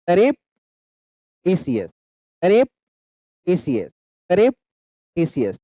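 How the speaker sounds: "surreptitious" very slow, long gap after "surrep"; "surrep" rising, pause, "titious" falling; careful enunciation drill. Shown as a demonstration. Teacher models correct enunciation to learners, slow split drill. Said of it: "सही एनंसिएशन के साथ पढ़ते हैं".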